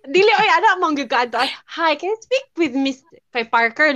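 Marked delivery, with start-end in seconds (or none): 1.7-3.9 amateur American phone voice; American accent with strong r sounds; attempted American accent. Shown as a demonstration. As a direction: try an American accent on a business call. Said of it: "di ko kabalo mag-American accent".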